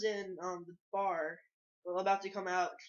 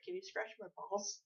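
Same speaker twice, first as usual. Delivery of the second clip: quiet, awkward mock request; feigned awkwardness; 10 dB quieter than usual. Retelling his prank on strangers, feigned awkward and quiet. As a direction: ask it softly, pretending to be awkward. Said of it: "I pretended to be, like, awkward".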